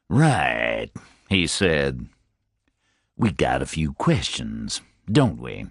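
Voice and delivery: low, rumbling detective chief's voice; low, rumbling voice of a calm chief of detectives; deep rumble. A narrator's reading. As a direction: let it roll low from the chest. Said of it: "The voice was deep, like a rumble".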